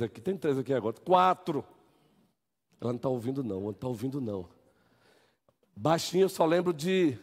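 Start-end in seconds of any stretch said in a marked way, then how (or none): 2.8-4.5 joking aside. Real, not acted. Joking aside about a woman.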